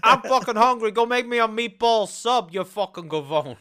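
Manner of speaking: loud, hammed-up Italian-American demand; mock Italian-American. Brit parodying an Italian ordering food, brash mock Italian-American accent. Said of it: "it's always like with the Italians".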